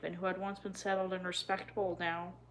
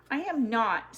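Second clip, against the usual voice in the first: louder, sharp indignant denial; hot protest; 4 semitones higher, 6 dB louder, slower than usual. A narrator's reading. Girl hotly denies being scared of trains. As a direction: snap back, stung. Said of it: "said Kit hotly".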